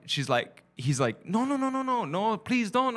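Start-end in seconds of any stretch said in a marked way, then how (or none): 1.3-2.9 high mock begging, no no no; imitating the dad's hammed-up pleading act. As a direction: beg high and frantic in a fake voice. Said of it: "And he's doing his voice".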